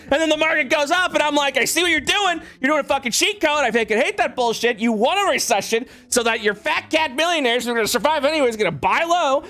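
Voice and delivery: very high-pitched accusatory ranting; worked-up, incredulous rant at high pitch; indignant tirade. Real, not acted. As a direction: call out the cheat, voice climbing well up. Rant peaks, accusing him of cheating.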